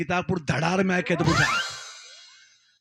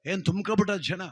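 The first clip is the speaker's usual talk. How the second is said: normal talking voice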